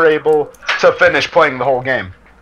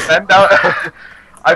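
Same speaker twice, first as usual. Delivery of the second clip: laughing while talking. Real, not acted. Laughing through the story.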